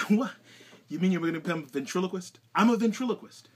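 loud, high disbelief; can't believe it, shouting; incredulous. Real, not acted. Shocked at ventriloquist news.

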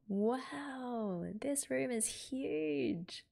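high, drawn-out amazement; high-pitched wowed voice; awed wonder. Acted. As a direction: marvel at it, stretching the last word. Voicing awed character seeing room.

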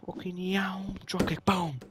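excited whisper. Real, not acted. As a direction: whisper it like a secret attack plan. Hyped whisper about dropkick plan.

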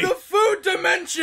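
loud, high mock-epic cry; amazed shout. Player announcing the game's twist to co-host, amazed shout. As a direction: proclaim it in hammed-up astonishment.

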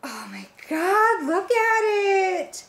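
high, slow squeal of delight; gasp, then squeal it out long and high; head-over-heels thrilled, very high; adoring squeal. Real, not acted. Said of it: "I am so in love with this box".

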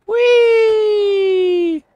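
very high, loud, long-held squeal; gleeful whee. Real, not acted. Gamer sliding down a slope, gleeful squeal. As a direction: let out a long playful whee on one high note.

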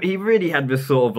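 ordinary talk

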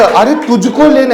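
high, loud scoffing correction; mock judge correcting. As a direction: jeer back the right word. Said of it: "परत पाठवलं".

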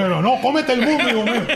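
high, mock-tender cry to a son; lightly playing the proud mother. Retelling the airport mother's farewell shout. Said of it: "Es como que".

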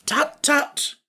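high-pitched tutting reproof; cautioning. A narrator's reading. Scarecrow cautioning Jack, high-voiced. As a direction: tut at someone to hold them back. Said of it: "cautioned the Scarecrow".

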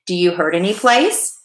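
plain reading voice

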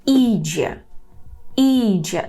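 Іджє with a long, stressed first і. Shown as a demonstration. Teacher models Polish for learners, stress on і in Іджє. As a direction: lean on і in Іджє, let джє fall. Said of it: "Наголошуємо і".

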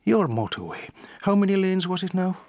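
a sly little leprechaun's punchline. Acted. Solicitor telling a golf joke, lightly voiced leprechaun. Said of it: "the leprechaun looks at him for a moment and says".

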